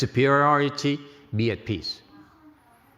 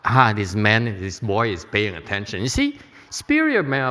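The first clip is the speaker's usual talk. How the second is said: ordinary delivery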